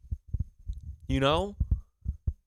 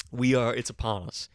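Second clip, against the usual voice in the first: ordinary talk, nothing marked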